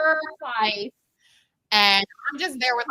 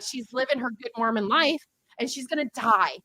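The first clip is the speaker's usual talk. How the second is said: usual speaking voice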